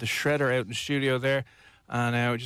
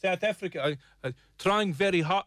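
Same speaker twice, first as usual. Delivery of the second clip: higher South African accent attempt, slipping away; faltering South African accent; 5 semitones higher than usual. Host strains to regain the accent, higher faltering South African attempt. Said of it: "Oh, no, we've lost it".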